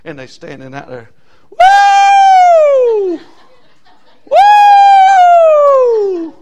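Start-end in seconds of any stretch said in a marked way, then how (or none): 1.5-6.3 very high, loud whooping cheer; fan whoop. Retold or imitated. Preacher imitating painted football fans, loud high whoop.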